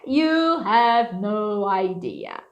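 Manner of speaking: slow drawn-out You have no idea; You have no idea with slow exasperation; dramatic, slow. Shown as a demonstration. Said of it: "Meu Deus". (You have no idea dramático e lento)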